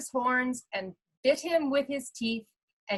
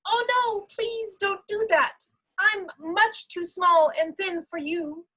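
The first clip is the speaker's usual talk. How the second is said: much higher, pleading little goat; 8 semitones higher than usual. Acted. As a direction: beg the troll in a tiny goat voice. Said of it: "in his not very loud little voice".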